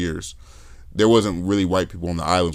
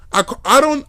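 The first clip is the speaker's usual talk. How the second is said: high, louder reenacted protest; flustered protest; 5 dB louder than usual. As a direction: stammer a high-pitched defence. Acting out flustered self-defence.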